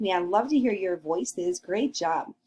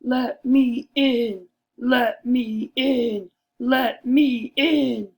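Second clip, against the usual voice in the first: slow, louder wolf demand; angry wolf; 3 semitones higher, 6 dB louder, much slower than usual. Acted. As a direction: bang on the door, slow and loud.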